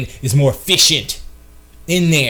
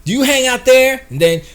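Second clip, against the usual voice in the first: eager flirt; 6 semitones higher than usual. Shown as a demonstration. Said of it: "Then you say".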